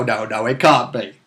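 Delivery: usual speaking voice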